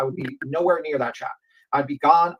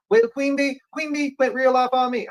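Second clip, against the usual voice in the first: much higher mocking imitation of a complainer; mocking whine; 9 semitones higher, 3 dB louder than usual. Quoting someone who blamed Queen Bee, high mocking imitation. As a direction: mimic the whiny complaint, pitch way up. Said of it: "You said that".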